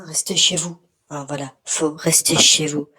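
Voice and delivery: loud shouted plea to stay home; shouting. Real, not acted. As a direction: yell it at the viewers. Urging viewers to stay home.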